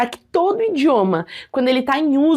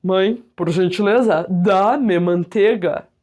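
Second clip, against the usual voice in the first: slow, lower, stiffly formal request; mock formal speech; 6 semitones lower, slower than usual. Teacher acting out a stiff formal request to mom, slow and lower. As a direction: play it as an absurdly formal child asking mom.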